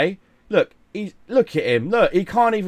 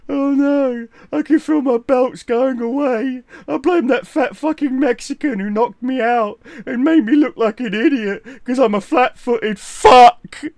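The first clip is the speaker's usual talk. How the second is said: high, tearful mock whine; hammed-up sobbing complaint, pitched high; whining beaten boxer; 7 semitones higher, 3 dB louder than usual. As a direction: wail in self-pity, building to a loud cry. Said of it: "you're just laying there going".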